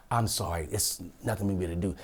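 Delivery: hushed retold apology. Teller voices the apologetic teller.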